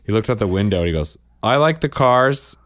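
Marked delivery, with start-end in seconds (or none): 1.4-2.6 Steve Carell impression. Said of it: "Steve Carell from Anchorman".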